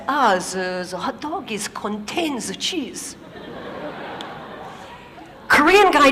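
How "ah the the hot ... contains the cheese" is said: lightly put-on French accent, z for th; hint of French engineer. French engineer explaining hot dogs to Korean colleague, light French accent. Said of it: "French guy says".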